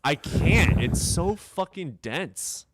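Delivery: loud, high, drawn-out complaint; real exasperation, slowing on the complaint; exasperated outburst. Real, not acted. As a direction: throw up a loud protest of defeat. Exasperated about a difficult book.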